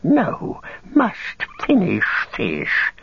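high, slow, stubborn creature; petulant creature whine; Gollum's creature voice. Acted. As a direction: squeal the refusal, dragging out the words. Gollum refuses to leave food.

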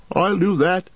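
innkeeper Butterbur. Acted. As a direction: chatter like a nervous landlord.

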